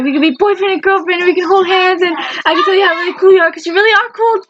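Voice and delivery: put-on high, bubbly, gushing girlfriend voice; excited Jane. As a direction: gush it out high and thrilled. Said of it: "she got all excited".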